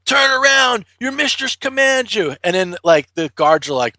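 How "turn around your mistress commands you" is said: high, commanding witch yelling at her beast; angry witch. Retold or imitated. Witch yells at the runaway dragon, high commanding mock rage. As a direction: scold the dragon like a furious sorceress. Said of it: "she was like".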